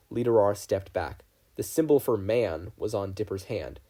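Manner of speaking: plain reading voice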